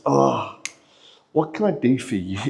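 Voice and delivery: low, soft, tender offer of help; Jesus. Acted. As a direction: drop low and ask gently, with a small sigh first. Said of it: "The tone is wildly different in the Greek".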